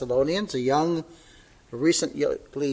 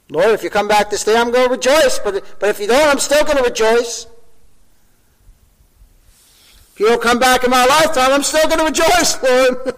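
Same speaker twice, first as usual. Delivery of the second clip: much higher and louder, exultant; joyful preached prayer; 9 semitones higher, 7 dB louder than usual. Acted. Pastor voicing a believer's prayer of rejoicing, exultant preaching. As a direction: cry out to the Lord in joy.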